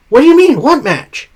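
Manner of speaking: high, startled "what do you mean?"; confused surprise. Retold or imitated. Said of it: "Finn then goes".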